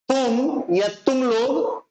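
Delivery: normal talking voice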